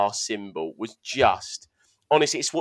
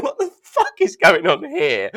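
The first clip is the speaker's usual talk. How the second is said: mock baffled; 5 dB louder than usual. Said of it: "He's like".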